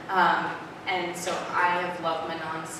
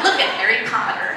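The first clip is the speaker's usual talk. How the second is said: laughing, louder and higher, tossing it off; laughing retort; 6 semitones higher, 11 dB louder than usual. Retold or imitated. To her editor, laughing as she insists on length. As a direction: laugh through the comeback.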